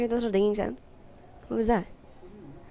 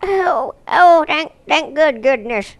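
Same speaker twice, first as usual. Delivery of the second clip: high-pitched, gushing character voice; hammed-up relief; 8 semitones higher, 6 dB louder, slower than usual. Crewman relieved that the girl is safe, high put-on voice. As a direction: cry out in exaggerated relief.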